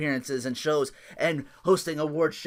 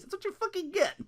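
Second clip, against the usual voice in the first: gleeful taunt; 5 dB quieter than usual. Real, not acted. Gleeful reaction to the match.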